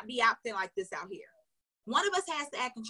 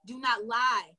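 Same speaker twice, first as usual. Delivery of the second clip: ordinary delivery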